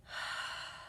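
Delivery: long soft audible exhale; deep breath out. Shown as a demonstration. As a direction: breathe out slowly so they can hear it. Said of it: "Everybody take a deep breath with me".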